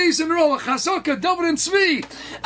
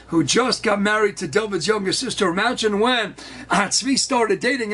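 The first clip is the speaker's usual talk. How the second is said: ordinary delivery, nothing marked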